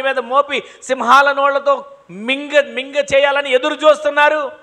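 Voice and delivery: loud, rising preacher's challenge; a pastor stirring up the congregation with loud questions; preaching at full force. Acted. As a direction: hammer each question at the congregation.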